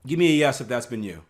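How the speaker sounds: ordinary talk, nothing marked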